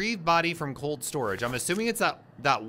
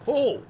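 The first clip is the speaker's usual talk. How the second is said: short, high, loud "oh!"; jumpy, high-pitched exclamation; startled yelp; 10 semitones higher, 7 dB louder than usual. Real, not acted. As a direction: yelp in sudden fright. Startled while playing horror game.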